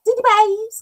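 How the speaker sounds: high, loud sing-song pet name; mock affectionate "Dee Dee babes"; imitating their greeting. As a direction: chirp it like friends greeting each other. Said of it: "that's how they say it to each other".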